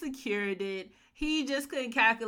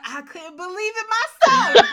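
mock stunned Bruce; 12 semitones higher, 15 dB louder than usual. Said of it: "he was sitting there like".

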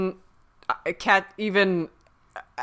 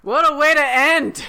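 high, loud burst of excitement; excited exclaim; 7 semitones higher, 5 dB louder than usual. Real, not acted. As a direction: blurt it out thrilled. Reacting to season finale.

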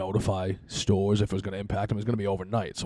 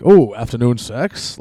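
loud, slow, teasing ask; loud joking question; 3 semitones higher, 13 dB louder, slower than usual. Retold or imitated. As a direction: call it out loud and cheeky. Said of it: "I'm like, kinda loud".

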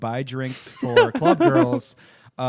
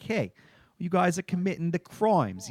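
mock Brooklyn cop accent; 7 semitones higher than usual. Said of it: "So she's the Brooklyn cop".

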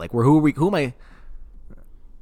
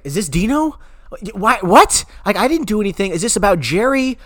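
panicked caller; 10 semitones higher, 5 dB louder than usual. Retold or imitated. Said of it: "he's like".

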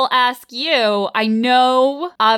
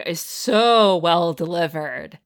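usual speaking voice

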